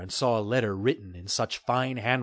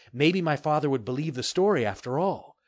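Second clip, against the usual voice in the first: plain reading voice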